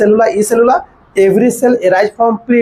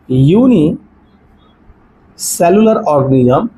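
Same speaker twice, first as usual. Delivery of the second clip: word by word, long pauses between, lower pitch; slow dictation; 4 semitones lower, much slower than usual. Real, not acted. Teacher writing out a point for students, slow dictation. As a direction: dictate it slowly as you write it out.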